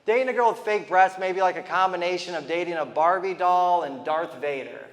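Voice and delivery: ordinary talk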